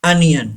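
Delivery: "onion" starting with a short uh, un-yun; correct pronunciation. Shown as a demonstration. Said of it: "Say this word". ("onion" का सही उच्चारण, "अनियन")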